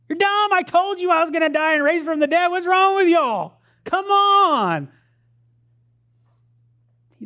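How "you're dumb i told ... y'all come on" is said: high, loud, exasperated hollering; mock furious yelling. Preacher playing himself as risen Son of God, yelling at the dummies. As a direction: yell it like you're fed up with them. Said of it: "I'm yelling at 'em".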